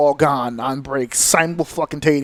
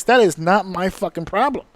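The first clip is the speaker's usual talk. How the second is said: ordinary talk, nothing marked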